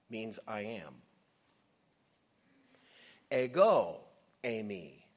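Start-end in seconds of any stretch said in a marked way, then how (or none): 3.3-5.0 "Ego" punched much louder, "eimi" falling away; emphatic. Shown as a demonstration. Preacher contrasting the Greek forms for congregation, emphatic. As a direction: hit "Ego" hard, pause, then "eimi". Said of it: "Emphatic. Emphasized".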